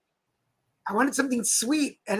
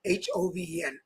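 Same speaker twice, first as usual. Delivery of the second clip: usual speaking voice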